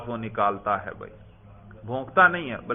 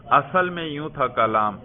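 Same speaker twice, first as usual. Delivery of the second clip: normal talking voice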